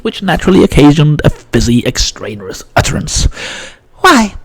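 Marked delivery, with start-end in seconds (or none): 4.0-4.4 put-on high, prim girl's voice; a very high, genteel young lady; posh little girl asking politely; Violet's voice. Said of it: "said Violet".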